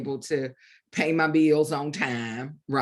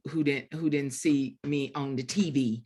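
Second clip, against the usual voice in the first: ordinary talk, nothing marked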